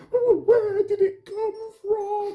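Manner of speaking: high, slow, loud panicky squeak; fretful worrywart. Acted. Worrywart fretting about the ball, slow panicky squeak. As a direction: whine it out, terrified.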